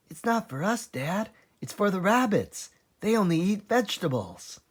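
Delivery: a little cub piping up; small fox cub. A narrator's reading. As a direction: smallest fox asking his dad.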